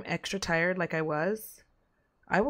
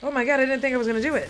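fast, loud, higher burst of relief; excited; 5 semitones higher, 6 dB louder, much faster than usual. Real, not acted. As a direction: blurt it out in disbelief. Finally beats a hard enemy.

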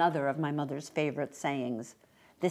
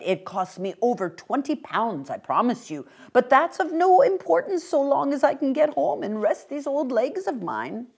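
put-on old man's voice climbing high and louder; persuasive little old gentleman; 8 semitones higher, 6 dB louder than usual. Old man urges mother to take umbrella, earnest and pleading. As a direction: press the offer, then plead.

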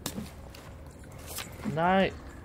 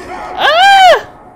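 loud high scream; startled scream; 17 dB louder than usual. Real, not acted. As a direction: scream as it grabs you. Attacked by monster.

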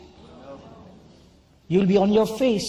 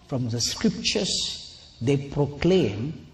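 the Lord's solemn voice; 3 semitones lower, 5 dB quieter than usual. Retold or imitated. The Lord tells the preacher of the church's rejection, low and grave. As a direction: speak as the Lord giving a grave verdict.

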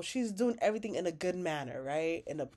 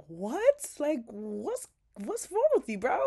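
shocked disbelief; 5 semitones higher than usual. Retold or imitated. The table reacting to Gary's joke, in shocked disbelief. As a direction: voice jumps up in disbelief.

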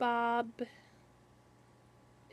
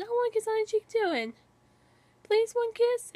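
much higher and louder, fast, pleading; high begging kid voice; 10 semitones higher, 4 dB louder, faster than usual. Acted. Bill begging Applejack for a kiss on the cheek, high and pleading. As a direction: beg for it like a little kid, voice pitched way up.

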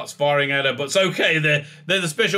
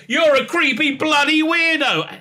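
high, louder sneering insult; hammed-up name-calling pitched up; mock insult; 12 semitones higher, 4 dB louder than usual. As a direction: jeer it up high like a petty child. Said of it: "she says".

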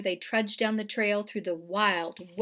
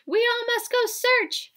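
much higher, louder chirpy bird; tweeting bird; 13 semitones higher, 6 dB louder than usual. A narrator's reading. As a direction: chirp it like a little wren. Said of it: "Wren tweets from his perch".

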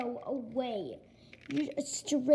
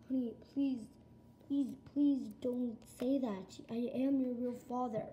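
ordinary talk, nothing marked

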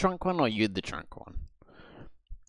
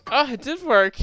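excited; 9 dB louder than usual. Real, not acted. Sudden success in game.

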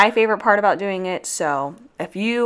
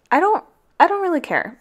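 high giggly dismissal; laughing; 10 semitones higher than usual. Real, not acted.

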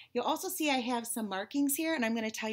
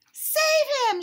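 very high, loud spider shriek; piercing cry of alarm; shrieked plea; 11 dB louder than usual. A narrator's reading. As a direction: shriek for help. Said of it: "shrieked a spider".

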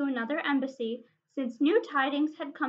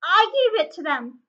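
higher, much louder burst of fright; frightened squeak; 5 semitones higher, 9 dB louder than usual. A narrator's reading. Frightened hobbit confesses to the dwarf king, squeaking. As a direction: blurt it out in a squeak. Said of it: "squeaked Bilbo".

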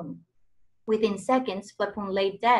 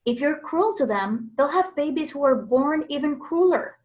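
calling out; 5 semitones higher than usual. A narrator's reading. Daisy warns the crowd.

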